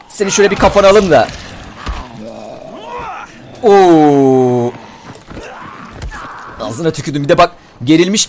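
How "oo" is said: long falling "ooh"; drawn-out ooh, sliding down. Real, not acted. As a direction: let out a drawn-out, lowered ooh. Reacts to the game.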